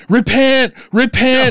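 high-pitched mock yell of zealots; retold yelling. Protesters yelling at convention-goers, mock yelling. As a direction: imitate street preachers calling out, pitched high. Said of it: "they all started yelling".

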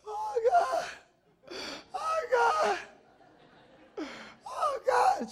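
very high, tearful mock plea; wailing sister. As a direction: cry out like a desperate little girl. Sister wailing while held down.

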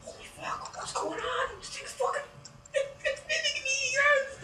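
high, breathy whispered panic; scared whisper. Retold or imitated. Bob watching the creature copy him, high scared whisper. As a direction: whisper in disbelief. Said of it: "So Bob's like".

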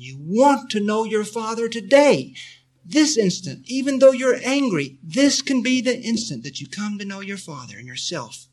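fervent exhortation. Real, not acted. Preacher urging listeners to know their Father, fervent and loud. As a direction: rise up and urge them with full conviction.

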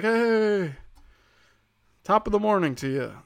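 lightly put-on Irish accent; mock Irish greeting. Irish on Saint Patrick's Day greeting, light Irish accent. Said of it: "they're like".

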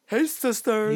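hammed-up influencer greeting; drawn-out mock vlogger hello; James Charles. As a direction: lift it into a showy greeting. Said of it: "you just call me James Charles".

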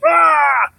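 high mock wail; imitated bawling. Teller imitating the wife bursting into tears, mock wail. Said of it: "she starts just bawling".

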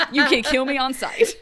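laughing through the words. Real, not acted. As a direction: crack up while saying it. Laughing at her own joke.

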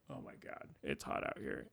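whispered, drained by the heat; voiceless moan of disgust at the heat; exasperated whisper. Retold or imitated. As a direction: breathe it out as a whisper, worn out. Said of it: "varying levels of just disgust".

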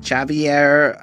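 "Javier" with a hard English J; deliberately wrong pronunciation. Retold or imitated. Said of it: "I can't even say it wrong".

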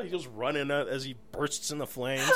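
normal talking voice

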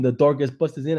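ordinary delivery

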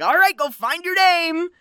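imitating a coach's announcement; coach calling out. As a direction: toss out a coach's dismissal to the players. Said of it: "the coach is just like".

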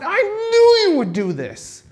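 high, slow, whining complaint; mock exasperated Jonah grumbling at God; whiny Jonah. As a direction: drag it out like a sulking prophet. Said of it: "He's repeating Exodus thirty-four six back to God".

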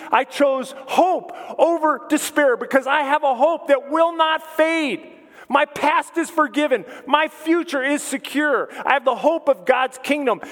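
high, loud revival preaching; full-force preaching. Acted. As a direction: preach it out with rising fervour.

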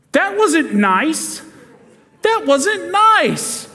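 put-on high, slow, wounded child's voice; a small girl scolding her mom, slow and high; hurt little girl. Little girl scolding her mother, high and slow.

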